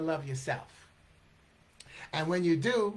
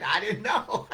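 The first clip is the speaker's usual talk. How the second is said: loud, higher laughing excuse; laughing protest; 4 semitones higher, 5 dB louder than usual. Retold or imitated. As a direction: protest your innocence through a laugh. Acted defence, laughing.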